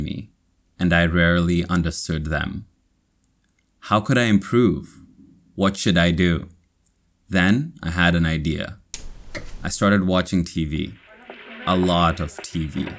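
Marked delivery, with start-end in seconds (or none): none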